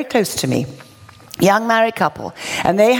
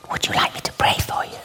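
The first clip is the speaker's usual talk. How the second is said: tiny whispered question; small hushed voice; very little voice; 6 dB quieter than usual. Retold or imitated. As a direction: barely breathe the offer, timid. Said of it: "I said it in a very little, small voice".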